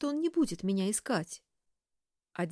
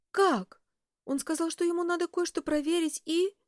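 anxious; 10 semitones higher, 4 dB louder than usual. A narrator's reading. Maid alarmed by news.

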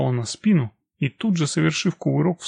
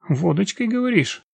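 drawled; 6 semitones higher than usual. A narrator's reading. Woman turns to the young man, drawling her question. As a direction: stretch the question out a little, higher. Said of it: "протянула я".

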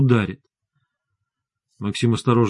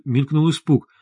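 ordinary delivery

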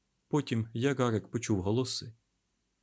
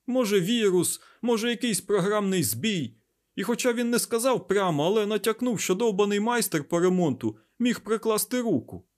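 high, loud, heated explanation; worked-up, insistent, high-pitched; fervent; 11 semitones higher, 5 dB louder than usual. A narrator's reading. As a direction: press the point with great fervour. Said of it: "Айзековий запал утихав".

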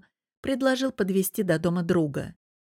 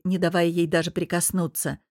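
ordinary delivery, nothing marked

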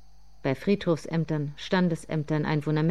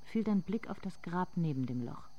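soft, intimate narrating voice; softly spoken inner monologue; hushed story narration; 12 dB quieter than usual. A narrator's reading.

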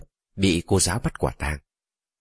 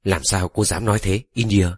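usual speaking voice

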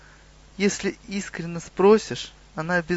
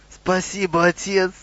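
higher-pitched, delighted thanks; overjoyed; 5 semitones higher, 4 dB louder than usual. A narrator's reading. Goblin thanking Guga for the free horses, overjoyed. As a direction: gush with grateful delight. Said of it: "обрадовался гоблин".

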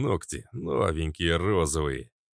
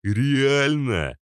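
mocking thug; 7 semitones higher, 5 dB louder than usual.

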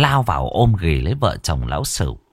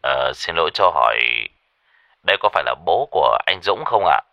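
low, polite phone caller; deep, warm man; 4 semitones lower, faster than usual. Acted. Stranger asks for Dũng's father, deep and warm. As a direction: ask courteously in a deep, warm voice. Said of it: "giọng nói của một người đàn ông trầm ấm".

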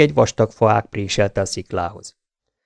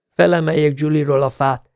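higher, breathy panting voice; 6 semitones higher than usual. A narrator's reading. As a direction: gasp it out from the effort. (lihegve, magasabb hangon)

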